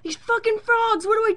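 loud, high, frantic plea to Sandy; high pitched complaint about noisy frogs; frantic whiny yell. Retold or imitated. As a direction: yell at Sandy like you're losing it. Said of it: "And I'm like".

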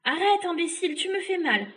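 high, loud protest; pained witch. Acted. Witch to the boy knotting her, high pained protest. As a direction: cry out as you're squeezed.

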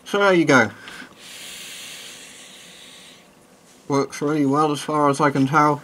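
muffled behind rubber mask; speaking through mask voicemitter; through a gas mask. Real, not acted. Seen 5: Rubber gas mask worn over mouth throughout; references show bare face.

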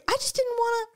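high-pitched snooty protest; hoity-toity. Acted. Host admitting snobbery about the driver, high hoity-toity. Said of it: "this is me being hoity-toity".

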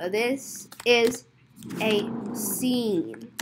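slow word by word. Acted. Telling customers it is a scene, slowly. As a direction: pause between the words, softly.

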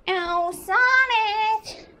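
high, slow call; drawn-out searching call; singsong calling out. Acted. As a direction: stretch the name out in a singsong. Amy calls out for Sonic.

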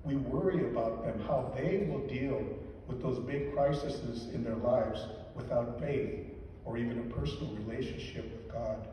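ordinary delivery

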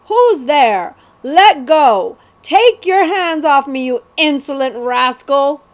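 loud, high indignant bellow; squawks and bellows. A narrator's reading. Prince grabbed by a stranger in fog, squawking and bellowing. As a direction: squawk in outrage like a seized prince. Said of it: "The prince burst into squawks and bellows".

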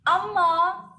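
loud, high rising wail; drawn-out begging cry of Amma; beggar's wail. A narrator's reading. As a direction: wail long toward the house. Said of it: "came a wail from the gate".

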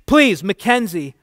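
high, loud, desperate pleas; crying and begging. A narrator's reading. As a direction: beg frantically, climbing to a cry. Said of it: "crying and begging".

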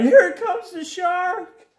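high, loud, stretched falsetto cry; hammed-up terror from a cartoon turtle; mock terrified. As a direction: wail the alarm slowly, right up high. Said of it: "The turtles were terrified".

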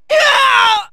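high, drawn-out "aah"; pained scream. Acted. Fighter badly hit, high pained yell. Character hurt in battle.

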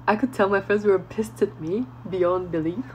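usual speaking voice

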